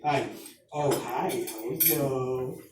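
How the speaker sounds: slow, drawn-out imitated greeting; dad's call-waiting switch. Dad answering call waiting, slow drawn-out greeting. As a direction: stretch out a low phone greeting. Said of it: "then he'd get the call waiting".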